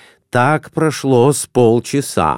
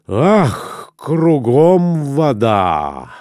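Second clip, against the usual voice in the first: sighing; 3 semitones higher, much slower than usual. A narrator's reading. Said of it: "вздохнул он".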